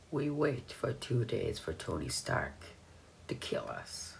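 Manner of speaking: put-on foreign accent, soft and drawn out; Wanda's accent. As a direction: imitate her in your best foreign accent. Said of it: "That was my best accent".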